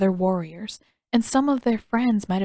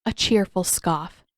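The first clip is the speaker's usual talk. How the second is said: usual speaking voice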